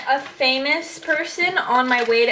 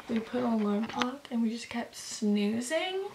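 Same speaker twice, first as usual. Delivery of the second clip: whispering; 10 dB quieter than usual. Real, not acted. Quiet morning talk.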